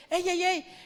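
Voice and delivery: reprensión actuada, más aguda y fuerte; llama al orden con voz alzada; llamada de atención. Acted. Jesús reprende a los discípulos dispersos. (Jesús reprende a sus discípulos, llamada enérgica actuada)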